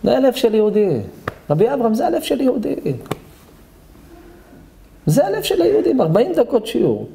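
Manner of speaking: high, rising exclamation; impassioned admiration. Real, not acted. Rabbi to Rabbi Avraham about the crying man, impassioned. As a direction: marvel aloud at his heart.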